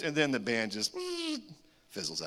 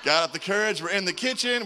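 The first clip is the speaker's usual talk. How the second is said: ordinary talk, nothing marked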